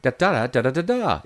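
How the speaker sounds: longer British lilt on "da" syllables. Shown as a demonstration. Coach shows another key lilt, British intonation on nonsense syllables. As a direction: trot out a British speech tune in da-das. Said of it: "I also like to use".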